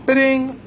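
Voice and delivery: high playful ding; mock cash register ding. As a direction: sing out a till chime. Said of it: "So it's like".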